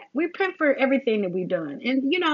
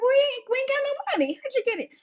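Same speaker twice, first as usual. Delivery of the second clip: shocked self quote; 14 semitones higher, much faster than usual. Retold or imitated. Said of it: "And I'm like".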